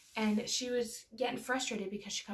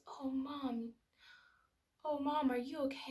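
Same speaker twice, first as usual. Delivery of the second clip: put-on soft, higher, hushed child's concern; tender concern; 4 semitones higher, 3 dB quieter than usual. Daughter climbing onto crying mother's lap, tender concern. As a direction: imitate a little one gently checking on Mom.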